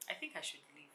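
whispered thought. Retold or imitated. Quiet decision at home.

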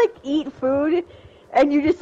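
ordinary talk, nothing marked

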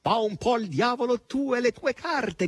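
high shout with a laugh; raucous laughing shout. A narrator's reading. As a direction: yell it cackling. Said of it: "gridò Natascia, ridendo sguaiatamente".

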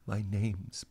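breathy whispered reveal, soft and secret. Retold or imitated. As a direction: lean in and whisper the name like a secret. Reveal line at show's end.

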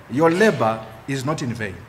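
ordinary talk, nothing marked

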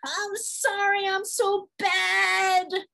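put-on high, loud, drawn-out childish wail; hammed-up shamed child apologizing; forced child's apology. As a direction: stretch each word in an exaggerated child voice. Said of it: "We're telling them to say".

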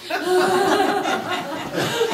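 high-pitched burst of real laughter. Real, not acted. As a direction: laugh hard at your own joke. Laughs at own punchline.

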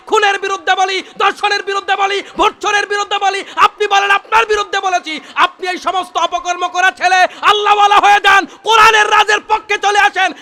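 high, loud sermon climax; preaching at full force. Acted. Preacher rebuking critics before the crowd, shouting. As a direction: drive every charge home at the crowd.

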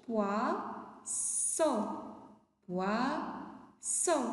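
poisson split by a held gap; syllable-by-syllable model. Shown as a demonstration. Teacher answers pupils' fish question, syllable by syllable. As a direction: stretch and separate the syllables of poisson.

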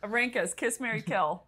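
ordinary talk, nothing marked

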